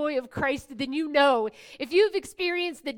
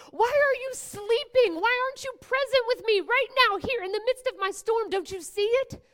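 much higher, loud desperate call; crying out in desperation; 9 semitones higher, 6 dB louder than usual. Acted. Disciples in the sinking boat crying out desperately to the Lord. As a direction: call for help as if drowning. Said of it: "crying out in desperation".

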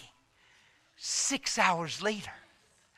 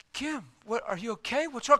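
startled concern; 7 semitones higher than usual. Retold or imitated. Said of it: "I said".

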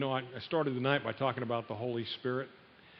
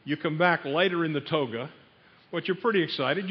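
ordinary talk, nothing marked

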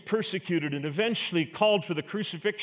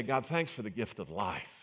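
much softer, lower grateful prayer; hushed prayer; 9 semitones lower, 9 dB quieter than usual. Pretend. Preacher voicing a morning prayer of thanks, hushed and low. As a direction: breathe it to God like a first waking thought.

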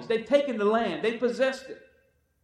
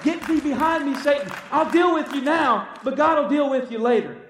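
shouted rebuke; 2 semitones higher, 4 dB louder than usual. Retold or imitated. Preacher telling the congregation how he rebukes Satan, with a loud shout. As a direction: bark the rebuke like you mean it. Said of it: "Come on, I said".

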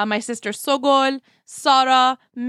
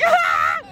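shrieking laugh; high squealing laugh; 3 dB louder than usual. Real, not acted. Laughs at imitation.

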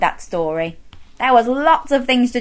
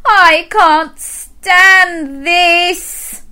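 very slow, loud, higher complaint; exasperated Mister Bear; 7 semitones higher, 5 dB louder, much slower than usual. Acted. Mister Bear kept awake by birds, slow exasperated wail. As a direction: stretch each word in exasperation. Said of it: "said Mister Bear".

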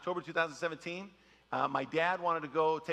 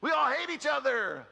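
a hint of a mock fan comeback; rival fan retort; 7 semitones higher, slower than usual. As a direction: tease back, higher pitched. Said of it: "And I'm like".

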